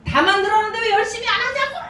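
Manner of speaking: fast, high-pitched mock yell; frantic scolding. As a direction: imitate a boss despairing at idle listeners. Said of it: "그러면서 난리를 치더라고요". (난리 치며 다그치는 흉내)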